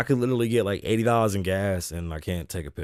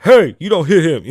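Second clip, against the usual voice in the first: loud, high heroic challenge; mock hero; 7 semitones higher, 12 dB louder than usual. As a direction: shout it like a would-be rescuer. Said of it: "gotta be like a hero".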